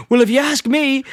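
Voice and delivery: high-pitched, huffy imitation; mock indignant retort. Homer retold snapping back at director.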